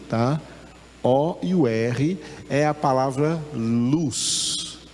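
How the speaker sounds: ordinary delivery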